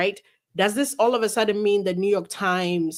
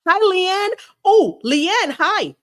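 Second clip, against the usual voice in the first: excited greeting; 10 semitones higher, 6 dB louder, much slower than usual. Real, not acted. Greeting lawyer joining the stream.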